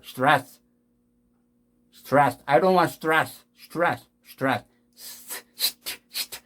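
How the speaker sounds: 스트레스 as English "stras", st only breath; voiceless st. Shown as a demonstration. Teacher drilling the cluster, voiceless English st. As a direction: make 스트 pure hiss and click. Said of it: "무성음으로 에스티가 처리돼야".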